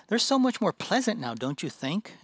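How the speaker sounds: put-on airy sing-song girl pleased with her bunnies; sweet girl.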